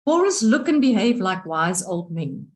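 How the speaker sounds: ordinary talk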